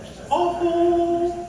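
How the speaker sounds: high, loud, long-drawn wail; comic wail. Real, not acted. Comedian to crowd about his own French, comic drawn-out wail. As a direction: stretch it out in a loud, high singing whine.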